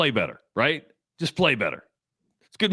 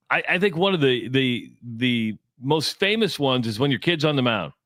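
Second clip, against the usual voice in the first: ordinary delivery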